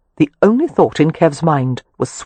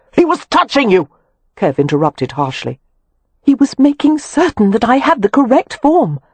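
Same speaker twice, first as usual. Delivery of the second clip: exasperated; 8 semitones higher than usual. A narrator's reading. Wynne correcting Kev about her costume, exasperated. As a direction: correct him, fed up. Wynne indignantly explaining herself.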